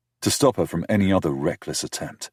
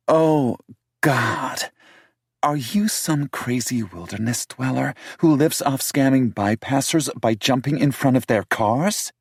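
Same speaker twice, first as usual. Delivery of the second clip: higher voice for the city woman, indignant; higher-pitched female character, accusing; 8 semitones higher, 4 dB louder than usual. A narrator's reading.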